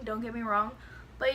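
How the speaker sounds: usual speaking voice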